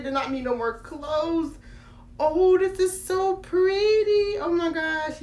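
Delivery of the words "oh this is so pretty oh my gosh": gushing. Real, not acted. Admiring doll clothes.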